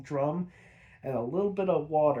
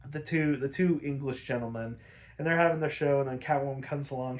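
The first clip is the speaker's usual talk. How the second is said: ordinary talk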